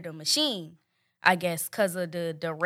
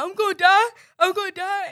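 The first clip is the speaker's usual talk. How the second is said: high, loud sobbing panic; frightened sobbing cry; 10 semitones higher, 6 dB louder than usual. Retold or imitated. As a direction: wail it through tears. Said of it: "So I'm just crying".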